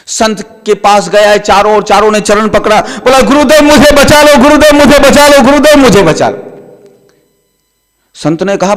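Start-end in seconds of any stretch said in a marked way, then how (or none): 3.2-6.4 higher, louder desperate begging; begging plea. Acted. Friends clutch the saint's feet, desperate begging. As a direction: beg the guru to save you.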